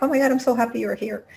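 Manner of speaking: high, fast, gushing mock-dog excitement; overjoyed greeting. Dogs finding you after the long day, overjoyed. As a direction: burst out like a dog reunited with its owner.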